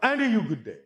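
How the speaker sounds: high, loud ringing hail; big projected welcome, pitched high; booming greeting. Real, not acted. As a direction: belt out a hearty salutation. Booming greeting to next questioner.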